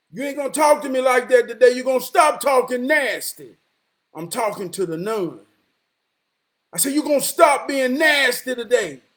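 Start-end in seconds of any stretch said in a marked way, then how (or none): 0.1-3.6 retold roar. Man telling off the nun who talked nasty, roaring. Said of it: "I roared a little bit". 6.9-9.0 loud scolding, pitched up a little; retold roar. Man repeating his warning to the nun, roaring. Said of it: "I roared a little bit".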